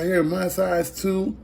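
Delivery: high, rising mock lady voice; surprised lady. Lady learning he wears her size, high surprised mock voice. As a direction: squeak it like an amazed stranger.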